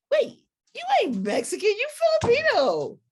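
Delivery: high, loud incredulous voice; squeaky stunned protest; disbelief. Retold or imitated. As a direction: sound floored that he isn't Filipino. Retold disbelief, high far voice.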